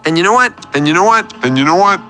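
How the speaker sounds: loaded rising question, repeated urgently; insistent buildup. Acted. Caller working up to a grievance for DJ, insistent. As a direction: build insistently toward a big point.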